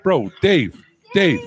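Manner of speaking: loud call across the room. Real, not acted. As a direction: call his name to get attention. Calling Dave on phone.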